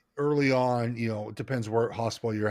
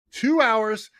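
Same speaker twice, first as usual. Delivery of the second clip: two hours pushed much higher; emphatic repeat; 10 semitones higher, 3 dB louder than usual. Retold or imitated. Begging the night nurse to let him sleep, pushing "Two hours" much higher. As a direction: plead the number with emphasis. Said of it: "pleading with nurse".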